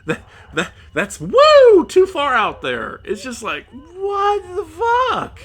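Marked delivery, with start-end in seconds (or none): 1.2-1.9 very high, loud "woo"; sudden high whoop of exasperation. Real, not acted. Exclaims how far-fetched it is. 3.7-5.4 high, very drawn-out cry; incredulous wail of real frustration; outraged disbelief. Real, not acted. Outraged rant at the plot.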